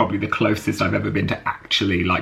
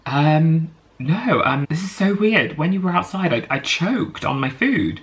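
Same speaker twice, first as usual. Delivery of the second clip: flustered retelling; 7 semitones higher than usual. Retold or imitated. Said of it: "And I just go".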